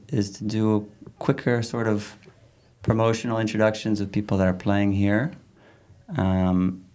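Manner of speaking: usual speaking voice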